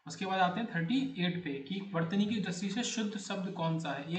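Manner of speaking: ordinary talk, nothing marked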